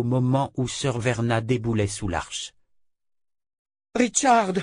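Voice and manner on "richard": appel furieux, beaucoup plus aigu; hurlement furieux. A narrator's reading. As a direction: crier le nom avec rage. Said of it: "hurla-t-elle furieuse". (hurler «Richard!» furieuse)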